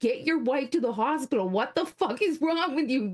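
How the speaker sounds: yelling. Retold or imitated. Host retelling yelling at Nick to go, exasperated high yell. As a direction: chew him out, pitch way up, words stretched. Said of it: "I'm, like, yelling".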